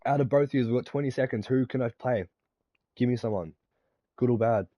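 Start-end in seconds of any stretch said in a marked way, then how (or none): none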